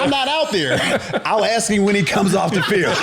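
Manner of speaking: high-pitched mock defensiveness, half laughing; exasperated protest. Assistant answering the coach about the quarterback, exasperated.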